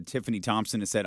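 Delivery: ordinary delivery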